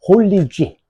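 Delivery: "홀리지" pronounced high and loud; pronunciation demo. Shown as a demonstration. Teacher teaching students the word for leaves, emphatic pronunciation. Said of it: "발음이 홀리지야".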